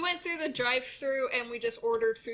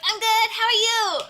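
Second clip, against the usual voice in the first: loud chirpy reply; 11 semitones higher, 10 dB louder than usual. Retold or imitated. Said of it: "I'm like".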